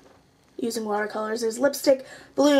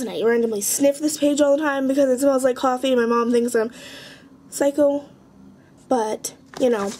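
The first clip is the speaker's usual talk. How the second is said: ordinary talk, nothing marked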